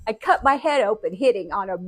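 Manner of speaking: ordinary talk, nothing marked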